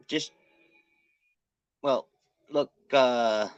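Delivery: normal talking voice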